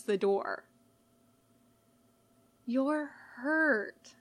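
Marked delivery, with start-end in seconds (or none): 2.7-4.1 Blue Eyes gently reminds the injured Sans; gentle. A narrator's reading. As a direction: say it gently, not to startle him. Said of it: "Blue Eyes says gently".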